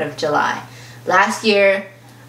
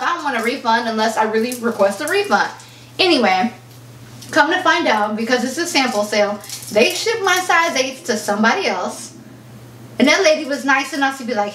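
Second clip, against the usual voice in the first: normal talking voice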